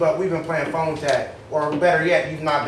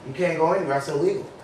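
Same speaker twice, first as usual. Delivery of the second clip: usual speaking voice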